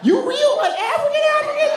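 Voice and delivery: squeaky, astonished Southern voice; surprised high-pitched Southerner. Acted. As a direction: let the voice shoot way up in amazement. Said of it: "their voices go up really high".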